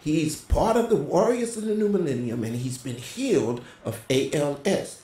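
high, loud, rousing stage proclamation; half-shouted retold altar call; proclaiming to a crowd. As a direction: lift the room like a preacher announcing a miracle.

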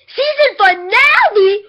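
drawn-out loud excited cry; slow, loud, thrilled shout. Real, not acted. Excited announcement.

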